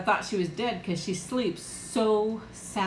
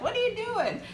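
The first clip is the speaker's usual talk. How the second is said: imitating husband; 13 semitones higher than usual. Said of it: "My husband's like".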